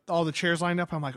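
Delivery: usual speaking voice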